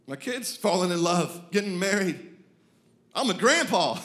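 excited astonishment. Acted. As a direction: marvel loudly at the milestones flying by. Racing through life's milestones aloud.

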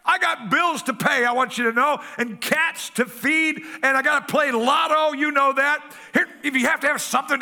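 hammed-up squawking grandma with bills to pay; hollering grandma. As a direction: holler it in their face like a grumpy granny. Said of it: "she hollers straight in your face".

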